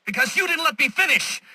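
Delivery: high, indignant snap; exasperated outburst. Acted. Villain interrupted by Batman, exasperated outburst. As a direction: burst out at being cut off.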